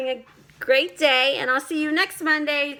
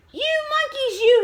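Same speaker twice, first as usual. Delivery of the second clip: scolding peddler; 10 semitones higher, 3 dB louder than usual. A narrator's reading. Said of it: "Finally, he spoke to them".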